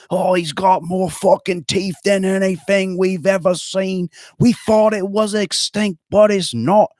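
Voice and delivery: mock Australian hero, high and overdone; awful Australian accent. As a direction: ham up a terrible Aussie shark hunter. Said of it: "Your accent is awful".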